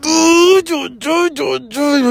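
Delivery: words stretched high through a yawn. Real, not acted. Tired vlogger yawning before wishing for a nap, real yawn.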